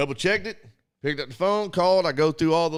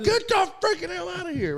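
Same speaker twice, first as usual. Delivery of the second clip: much higher, squealing disbelief; exasperated outburst. Retold or imitated. Caller bounced to India again, high squeal of disbelief. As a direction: shriek it in exasperation.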